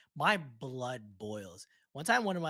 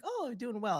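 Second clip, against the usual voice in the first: bright pitched-up reply voice; high cheerful reply; 10 semitones higher than usual. Retold or imitated.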